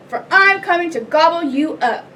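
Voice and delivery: forceful storybook troll menace. A narrator's reading. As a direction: threaten as a hungry troll. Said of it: "said the troll".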